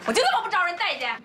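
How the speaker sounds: 高声委屈地质问; 不服气地抬高嗓门; 委屈质问. Acted. 儿媳与家人争吵. (委屈又恼火地质问)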